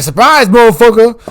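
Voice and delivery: spring it on him like a jump scare; cocky shout of surprise; mock gloating yell. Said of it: "I'm like".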